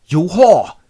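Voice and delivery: high, loud mock fury; accusing. Jealous husband accuses his wife, mock fury. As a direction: hurl the insult, hammed up.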